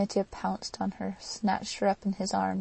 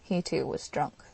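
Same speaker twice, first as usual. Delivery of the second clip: ordinary delivery, nothing marked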